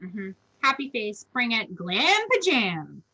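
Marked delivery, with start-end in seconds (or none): 1.7-3.0 slow, stretched-out playful name; savoring the words. Real, not acted. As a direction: roll it out slowly, enjoying it. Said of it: "It's just so fun to say".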